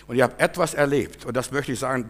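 ordinary talk, nothing marked

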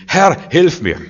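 high, loud desperate plea; cry for help. Acted. Peter sinking in the waves, crying out loud to the Lord. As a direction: shout it like a man going under. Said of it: "Petrus, als er beim Untergehen war".

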